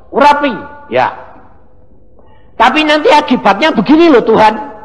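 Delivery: loud, high objection to God; protesting to God. Acted. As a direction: push back hard against the order.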